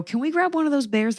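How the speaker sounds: high sugary polite request; overly polite high ask; sweet polite ask. Retold or imitated. Sweetly asking rink staff for bear.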